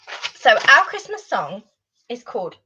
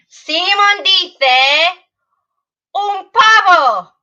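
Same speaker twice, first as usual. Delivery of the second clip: slow, louder, higher game call; 7 semitones higher, 8 dB louder, much slower than usual. Shown as a demonstration. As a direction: announce the command loudly. Calling out the real command.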